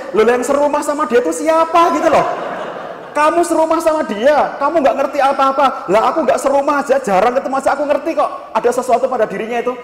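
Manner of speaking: high, insistent mock scolding; exasperated retort. Counsellor retorting to wife who lives with him, exasperated retort.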